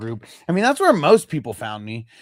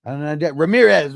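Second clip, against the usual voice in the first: usual speaking voice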